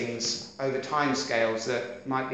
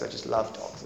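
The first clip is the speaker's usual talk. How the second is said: breathy hushed echo; quiet whispered repeat; 5 semitones lower, 6 dB quieter than usual. Shown as a demonstration. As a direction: whisper it softly.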